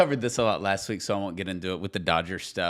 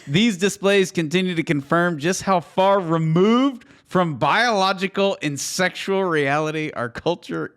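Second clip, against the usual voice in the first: slow, loud and raised, savouring every word; emphatic quote reading; 7 semitones higher, 8 dB louder than usual. Acted. Host relishes a Baptist quote, slow loud emphatic reading. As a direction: read it like a proclamation you love.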